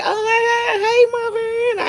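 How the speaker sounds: high, drawn-out squeal of recognition; loud slow squeal of joy at meeting her; excited squeal. Retold or imitated. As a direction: shriek with delighted surprise.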